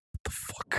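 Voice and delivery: stunned whispered curse; shocked whisper. Acted. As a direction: breathe it out in disbelief. Narrator's shocked reaction.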